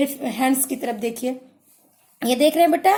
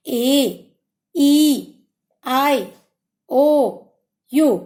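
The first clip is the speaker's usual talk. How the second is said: ए, ई, आई, ओ, यू, each vowel said slowly and clearly with pauses between; teacher showing the vowels for children to copy; vowels said one by one; 4 dB louder, much slower than usual. Shown as a demonstration. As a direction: say each vowel alone with a pause, for the class to repeat. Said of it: "चलिए repeat करिए मेरे साथ".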